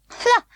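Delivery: sharp high scoffing "Ha!"; a quick high snort of scorn; scornful laugh. A narrator's reading. Walking doll scoffs at children, high laugh.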